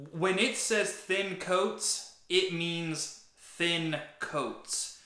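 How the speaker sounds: very slow, deliberate; slow emphasis. Real, not acted. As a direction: spell it out slowly. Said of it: "spelling out directions".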